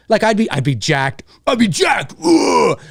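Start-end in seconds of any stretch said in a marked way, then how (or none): 1.5-2.8 mock pumped-up yell, higher pitched; play-acted burst of hype; hyped up. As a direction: act out getting jacked, ending in a roaring grunt. Acting out hypothetical hyped reaction.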